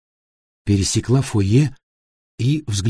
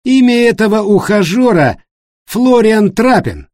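high, loud revelation, slowing on the name; dramatic accusation; 11 semitones higher, 6 dB louder than usual. Acted. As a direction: drop the name like a public charge. David's public accusation after a pause. (громкое обвинение, имя произнесено медленно и веско)

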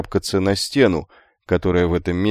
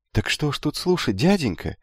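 high, soft, bewildered youngster; lightly pitched-up boyish voice; puzzled youth; 7 semitones higher, 4 dB quieter than usual. A narrator's reading. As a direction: ask in innocent puzzlement. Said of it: "недоуменно пожал плечами юноша".

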